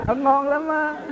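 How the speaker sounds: high, loud, drawn-out boast; joking praise. Real, not acted. Khen đùa bà Tám, cả nhóm cười. (khen đùa, giọng cao, to, kéo dài)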